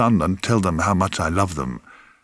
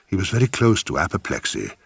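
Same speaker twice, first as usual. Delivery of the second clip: ordinary delivery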